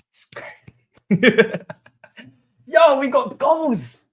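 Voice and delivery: high, slow giggling delight; laughing in disbelief. Real, not acted. Fan celebrating two goals to viewers, giggling high.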